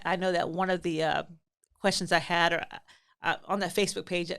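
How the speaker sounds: normal talking voice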